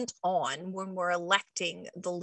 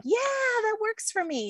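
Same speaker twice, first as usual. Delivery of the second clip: high, bright-eyed "Yeah, that works for me"; imitating a listener; 13 semitones higher than usual. Host voicing an emotional authority, high pleased voice. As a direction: pop way up like you're delighted. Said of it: "you may be like".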